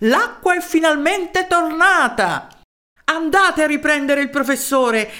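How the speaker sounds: high, elated proclamation; joyful exclamation. A narrator's reading. Mayor announcing water to townspeople, joyful high cry. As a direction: proclaim it to everyone, high and overjoyed. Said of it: "non si trattenne e urlò".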